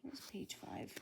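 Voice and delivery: whispered question to herself; whispering. Real, not acted. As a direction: whisper it while looking for the page. Muttered aside searching.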